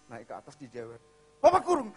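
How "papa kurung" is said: high, loud bark; threatening father. Retold or imitated. Father locking his son away, angry shout.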